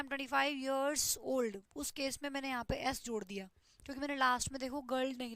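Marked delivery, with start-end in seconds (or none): 0.0-1.6 very slow, word by word; deliberate teacher dictation pace. Shown as a demonstration. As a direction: spell it out slowly for the class. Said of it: "तो मैंने बोल दिया".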